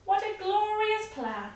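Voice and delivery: put-on high, pleased old lady voice; delighted old lady. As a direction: relish the plan.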